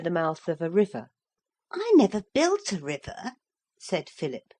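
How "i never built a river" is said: put-on a boy's puzzled protest, higher; lightly voiced boy, mildly puzzled; young boy. Philip tells the parrot about the river, boyish and puzzled.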